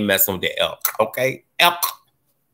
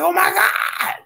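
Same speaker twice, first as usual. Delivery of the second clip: very high wail, retold from the ride; retold hollering; slower than usual. Rider reacting to the fast drop, retold hollering. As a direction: cry it out high, reliving the drop. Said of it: "But I was hollering".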